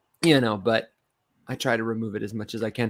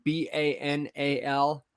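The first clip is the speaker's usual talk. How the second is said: slow letter-by-letter spelling; slower than usual. Shown as a demonstration. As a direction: spell B A N A L slowly. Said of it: "The word".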